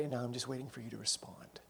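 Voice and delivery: hushed whisper, almost no voice. Acted. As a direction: breathe it out as a secret. Voicing God's patient words.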